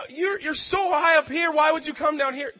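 high, loud amazement; a worker amazed that the boss came down; astonished question. Retold or imitated. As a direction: ask in disbelief. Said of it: "I was blown away".

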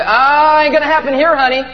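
high, loud defiant "Ah" sliding into sass; defiant outburst. Acted. Pastor modelling a comeback at three a.m., defiant. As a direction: throw it out loud, high and sassy. Models speaking back at the enemy.

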